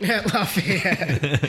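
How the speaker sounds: slow, drawn-out teasing repeat; laughing echo. Real, not acted. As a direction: stretch it out while laughing. Echoing the co-host with a laugh.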